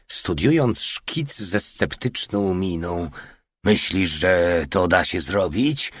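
ordinary delivery, nothing marked

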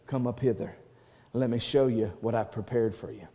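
soft, hushed call to come up; a soft whisper-like voice of God; still small voice. Acted. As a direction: speak it gently, like a quiet summons. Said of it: "a still small voice that says".

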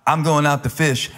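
put-on a light Southern country preacher accent. Pastor mimics a country preacher quoting Peter, mild Southern accent. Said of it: "I'm gonna do it in a country preacher accent".

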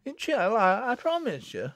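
high, meek, faltering promise; tearful promise. A narrator's reading. Susan submitting to Jennet, tearfully. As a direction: give in meekly after crying. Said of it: "drying her eyes".

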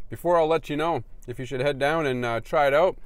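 food in mouth; muffled around a bite; talking while chewing. Real, not acted. Seen 1: Holds burger at mouth, lips closed and working between words.

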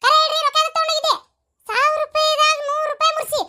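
put-on wife berating her drunk husband, shrill-pitched fury; angry rant. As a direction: tear into him for taking the money. Wife scolding husband over money.

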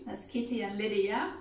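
usual speaking voice

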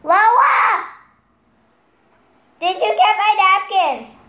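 loud, high holler; calling out. Real, not acted. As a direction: shout a demand to another room. Calling to someone in another room.

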